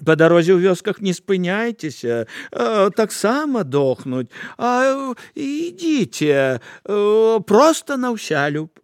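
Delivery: butter them up slyly; greedy woman sweet-talks the apostles; fawning. Acted. Woman sends them away sweetly.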